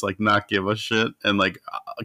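ordinary talk

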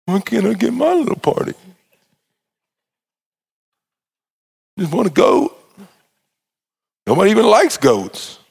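sulky pout. Acted. Son sulking after the rant.

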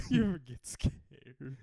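drawn out and slow, mimicking; slow, heavy mock of the question; mocking slow repeat. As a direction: stretch it out to tease. Why you say it like that?.

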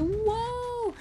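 very high, loud halt cry; yelled. A narrator's reading. Almanzo yells at the bolting calves, frantic. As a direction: yell for the runaway team to stop. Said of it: "yelled Almanzo".

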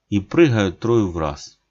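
ordinary delivery

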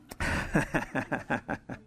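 breathy, voiceless chuckling; laugh. Real, not acted. Preacher after his own horse joke, chuckling. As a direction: run of short airy ha-has.

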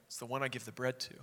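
soft, quick aside in confidence; whispered reply. Acted. Jesus answering the close disciple privately, hushed. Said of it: "leans down and whispers back to him".

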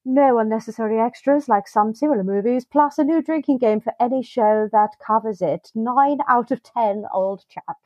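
a hint of an Irish English accent. Reading a listener's comment in a light Irish English accent. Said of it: "That was an authentic Ian accent".

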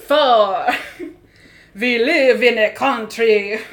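big, slow vampire declamation; hammy Hungarian-grandfather vampire; Dracula musical voice. Acted. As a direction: belt it out like a stage Dracula. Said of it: "the Dracula musical".

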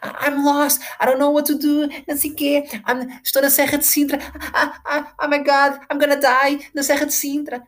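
high, whiny mock crying girl lost in the hills; mock her tearful panic; imitating crying girl. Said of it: "A chorar no TikTok, a fazer vídeos". (imitação chorosa e aguda de miúda perdida)

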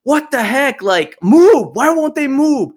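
high exasperated road-rage rant; imitated yelling. Retelling the babysitter ranting at stuck traffic, imitated high yelling. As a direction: complain loudly at cars that won't budge. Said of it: "She was just yelling".

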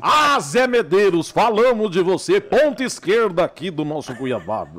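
anúncio alto e esticado; locutor de brincadeira. Pretend. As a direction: chamar o nome como narrador esportivo, devagar. Brinca de locutor chamando o político. (locução exagerada, bem projetada)

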